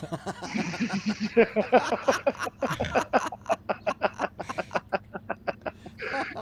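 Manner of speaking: long rising laugh. Real, not acted. Laughing at catcher joke.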